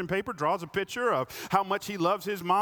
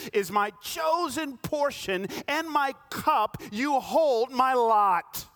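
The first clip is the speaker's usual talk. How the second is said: slow, louder declaration of faith; emphatic scripture; 6 semitones higher, 4 dB louder, slower than usual. Real, not acted. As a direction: proclaim each word slowly. Reading the psalm with feeling.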